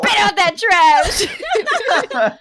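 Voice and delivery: loud, high, very drawn-out order; playful yell at a naughty pet, half laughing; mock scolding yell.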